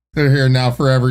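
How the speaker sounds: ordinary delivery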